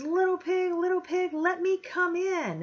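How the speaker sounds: high, loud wolf voice calling at the door; hammed-up storybook wolf; big bad wolf. Teacher voicing the wolf to her class at the door. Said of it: "and he goes".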